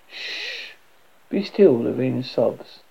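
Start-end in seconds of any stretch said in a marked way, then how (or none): 0.1-0.7 soft drawn-out shush; quiet "shh" to calm. Acted. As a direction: hush softly. Hushing a frightened companion.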